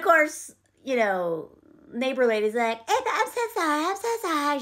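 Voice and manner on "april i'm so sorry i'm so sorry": high, hurried fake apology; mock neighbor's quick, high-pitched apology; insincere apology. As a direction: gush an embarrassed sorry you don't mean. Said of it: "She's really not sorry. She's just embarrassed".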